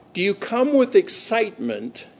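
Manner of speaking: usual speaking voice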